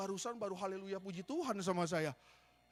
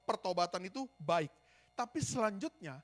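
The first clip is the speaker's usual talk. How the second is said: normal talking voice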